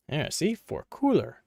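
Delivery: ordinary talk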